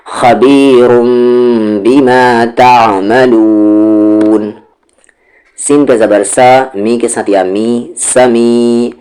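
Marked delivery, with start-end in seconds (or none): 0.1-4.5 बिमा तामलून read slowly, the noon drawn out; slow Quranic recitation. Shown as a demonstration. Teacher reciting the phrase for pupils, slow and drawn out. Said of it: "खींचते हुए".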